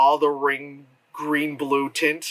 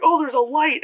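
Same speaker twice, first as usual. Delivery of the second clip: high, louder mock oh; mock surprise; 10 semitones higher, 4 dB louder than usual. Vlogger mocking Cloverfield character, high mock surprise. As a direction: play dumb amazement at a light. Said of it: "it was like".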